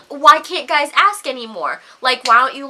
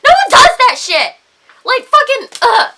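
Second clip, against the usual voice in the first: loud, high exasperation; disgusted outburst; 10 semitones higher, 7 dB louder than usual. Real, not acted. Woman appalled at men's staring, disgusted and exasperated. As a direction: sputter in frustration, ending in a groan.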